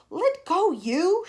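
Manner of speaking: a sudden high, loud yell; shout. Acted. Centipede yelling at James to let go. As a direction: bark it to shake someone loose. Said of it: "shouted the centipede".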